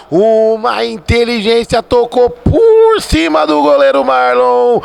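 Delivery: ordinary talk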